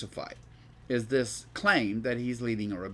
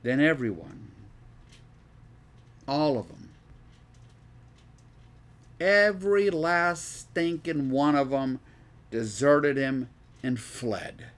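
emphatic scripture reading; 5 dB louder than usual. Acted. Reads verse with emphatic additions.

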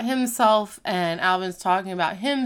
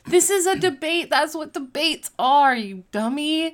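higher-pitched mock exasperation, slowing on the insult; exasperated retort; 6 semitones higher than usual. Teacher answering a colleague playing stupid, exasperated. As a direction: retell it as an impatient put-down.

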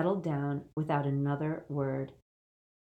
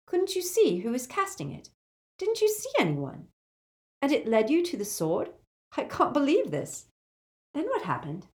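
higher, urgent schoolgirl; young girl voice; 9 semitones higher than usual. A narrator's reading. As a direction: fire off questions in disbelief. Hermione voiced by narrator.

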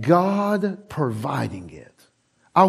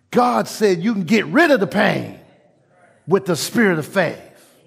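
normal talking voice

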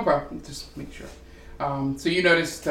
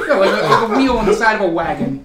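louder, higher through giggles; laughing; 6 semitones higher, 8 dB louder than usual. Real, not acted. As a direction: laugh while explaining. Explaining the wheel, laughing.

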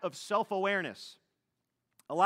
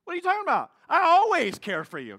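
much higher, loud, offended protest; mock indignant self; 11 semitones higher, 10 dB louder, faster than usual. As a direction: act hurt that anyone doubts you. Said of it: "unless it's something really good".

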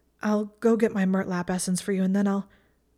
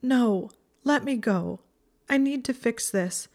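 higher, agitated protest; desperate insistence; 6 semitones higher, 3 dB louder than usual. A narrator's reading. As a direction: insist on being let go. Struggles to be let go.

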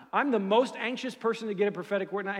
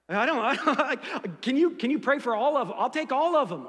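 laughing, high and loud, eager; 7 semitones higher, 8 dB louder than usual. Real, not acted. Pastor laughing as he asks for every gift. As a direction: giggle through the greedy ask.

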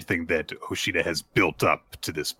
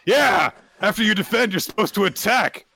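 high, loud eager battle voice; eager high kaiju voice; 11 semitones higher, 3 dB louder than usual. Retold or imitated. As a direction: urge him on like a thrilled fighter.

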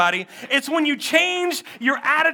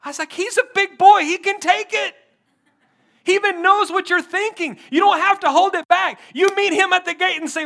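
higher, louder, driving sermon voice; full-force preaching; 5 semitones higher, 6 dB louder than usual. Acted. Pastor urging the church not to quit, full-force preaching.

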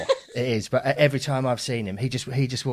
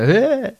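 loud mock ah; 13 semitones higher, 8 dB louder than usual. Imitates Spencer Jones' sound.

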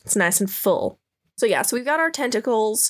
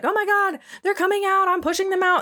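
high excited priestess; 11 semitones higher, faster than usual. Retold or imitated. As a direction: cry it out up high, thrilled. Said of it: "And she's like".

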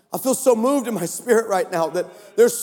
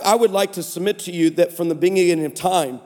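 ordinary delivery

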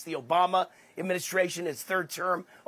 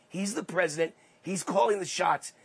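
ordinary talk

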